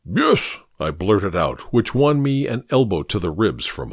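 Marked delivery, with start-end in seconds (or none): 0.1-0.6 sudden high blurt. Acted. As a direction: blurt it out before thinking. Watson blurts out.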